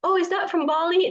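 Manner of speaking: a foreign tourist guessing Bali, voiced high and curious. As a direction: play a tourist who has only heard of Bali.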